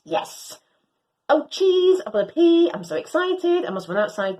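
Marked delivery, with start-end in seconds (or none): none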